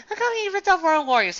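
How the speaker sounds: high loud exasperation; incredulous outburst. Real, not acted. Reviewer rants about the rip-off, high loud disbelief. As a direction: throw the question up in disbelief.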